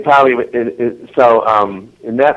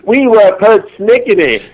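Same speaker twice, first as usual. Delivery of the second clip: high, slow, louder mock Southern belle; Southern character voice; 10 semitones higher, 5 dB louder, slower than usual. Guest agreeing with host's word persnickety, mock Southern voice. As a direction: own up grandly in hammed-up Southern. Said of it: "that southern thing got to me so".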